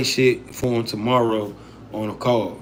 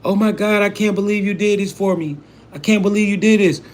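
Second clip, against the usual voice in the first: higher, gushing mock disbelief; mock overwhelmed gratitude; 6 semitones higher than usual. Someone gets handed money, mock gushing overreaction. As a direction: ham up being overwhelmed by a gift. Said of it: "acting like it's the end of the fucking world".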